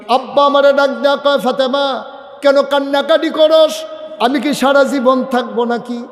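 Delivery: high, chanted retelling of a father's words; impassioned preaching. Real, not acted. Preacher dramatizes the story.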